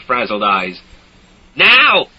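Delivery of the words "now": high, loud bark of a shout. A narrator's reading. Soldier shouting at villagers, high and loud. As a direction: snap it out loud. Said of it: "he'd shout".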